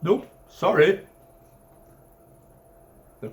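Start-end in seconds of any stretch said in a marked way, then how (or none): 0.0-1.1 loud, higher, slow flat no; blunt refusal. Acted. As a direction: shut him down cheerfully. Narrator bluntly refuses the colonel.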